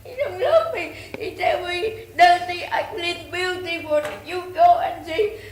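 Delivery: loud, high tearful wail; crying plea. Real, not acted. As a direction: wail your grievance through real tears. Crying, pleading about being treated badly.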